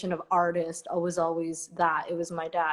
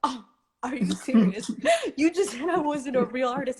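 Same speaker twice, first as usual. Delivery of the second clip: incredulous laugh, higher; 6 semitones higher than usual. Real, not acted. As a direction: laugh in disbelief. Laughing at the fan's reversal.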